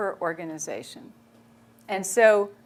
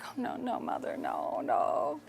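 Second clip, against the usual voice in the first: softer, half-whispered "no, no"; dismayed hushed refusal; 4 semitones higher, 8 dB quieter than usual. Retold or imitated. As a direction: recoil quietly from the order. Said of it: "I'm like".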